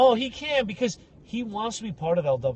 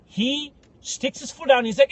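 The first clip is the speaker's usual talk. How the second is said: ordinary talk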